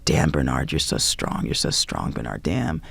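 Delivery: low hushed whisper into the ear; a low retold whisper in her ear; imitated whisper. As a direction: breathe it low and close, as he did. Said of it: "and whispered in my ear".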